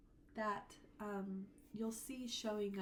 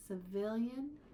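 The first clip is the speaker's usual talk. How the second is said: "civilian" with VIL punched louder; stress on VIL; 5 dB louder, slower than usual. Shown as a demonstration. Teacher contrasting stresses, weight on second syllable. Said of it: "the stress is somewhere a little bit different".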